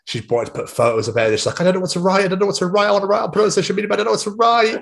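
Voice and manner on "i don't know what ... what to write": high-pitched mock weepy wail; imitated tearful fluster. Girlfriend wanting to post photos, imitated tearful fluster. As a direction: imitate an overwhelmed partner, voice pushed right up. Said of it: "And she cried some more".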